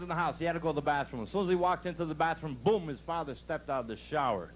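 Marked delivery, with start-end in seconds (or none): none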